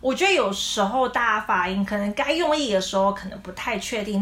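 ordinary talk, nothing marked

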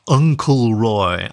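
"Uncle" drawn out, slow and leaned on; stress on Uncle. A narrator's reading. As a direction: stretch "Uncle" slowly and emphatically. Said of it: "with exaggerated emphasis on the word uncle".

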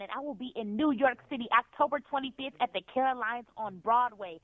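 usual speaking voice

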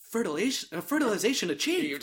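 mock sci-fi computer voice; mock robotic report. Shown as a demonstration. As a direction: read it like a lab computer. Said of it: "I probably did it better than her".

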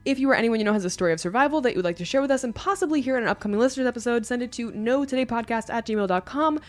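fast, upbeat sign-off plug; podcast outro read. Acted. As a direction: rattle off the socials brightly.

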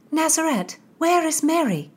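high worried question; anxious girl. Acted. Constance in dark.

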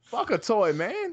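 high, loud angry blurt; loud outburst. Real, not acted. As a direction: spit it out in disgust. Outburst against toy marketing.

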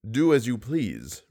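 low, measured voice of an unseen teacher; reply low as the mysterious voice. A narrator's reading. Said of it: "replied the voice".

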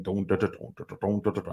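soft sung-out bass riff; imitated band playing. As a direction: mimic a band playing in the background, low and quiet. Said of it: "they're up there playing".

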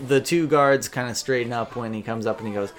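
ordinary delivery

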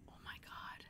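breathless whispered aside. Real, not acted. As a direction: whisper it under your breath. Embarrassed aside.

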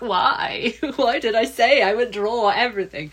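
mock dismay through a laugh; amused, exasperated self-questioning; laughing regret. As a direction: wail it jokingly at your own past self. Retells regret halfway through, laughing.

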